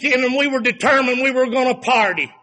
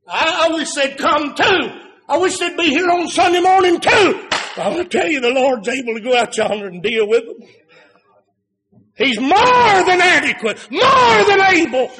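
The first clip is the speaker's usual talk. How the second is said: preaching at full force; 6 semitones higher, 4 dB louder than usual. Acted. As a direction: build up and shout it from the pulpit. Preacher rises to shout.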